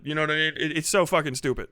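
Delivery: ordinary talk, nothing marked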